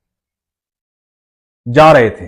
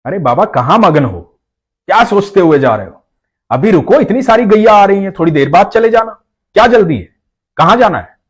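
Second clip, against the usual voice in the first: put-on higher, lively boy's coaxing; playful child voice; 6 semitones higher, 3 dB louder than usual. A narrator's reading. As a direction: coax the old man to wait. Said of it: "बाबा को बोल रहा है".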